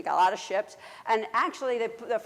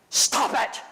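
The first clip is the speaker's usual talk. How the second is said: put-on a bossy boy's louder "Stop it!"; commanding shout; 5 dB louder than usual. Privileged twelve-year-old scolding the crying men, bossy command. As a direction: order them to stop. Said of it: "And he's like".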